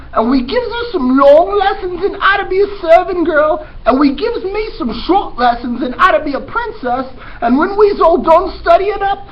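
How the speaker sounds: put-on high, loud, brash girl voice; eager Mig. Teacher voicing dim servant girl Mig, high and loud. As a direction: pitch way up and blurt it out loud. Mig's favorite part of plan.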